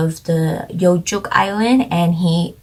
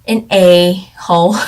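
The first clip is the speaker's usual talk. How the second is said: a-hole said louder and slower, leaning on it; emphatic jab; 7 dB louder than usual. Real, not acted. Vlogger calling the actor's character a jerk, loud emphasis. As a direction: punch out a-hole with a grin.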